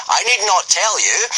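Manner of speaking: high, hearty booming voice; cheerful Gobber. A narrator's reading. Gobber threatening the boys, cheerful. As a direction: say it cheerfully like a Viking teacher. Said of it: "Gobber continued cheerfully".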